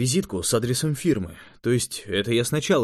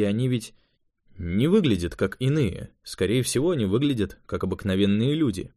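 ordinary delivery

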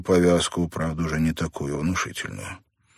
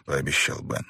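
usual speaking voice

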